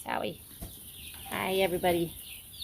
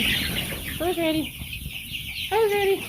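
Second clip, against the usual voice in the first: excited; 10 semitones higher, much slower than usual. Real, not acted. Hyping chicks before release.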